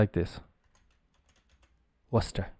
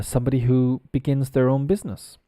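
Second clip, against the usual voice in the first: ordinary talk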